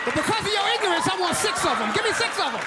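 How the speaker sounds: affronted, emphatic customer; indignant demand. Retold or imitated. Acted defiant order to cashier.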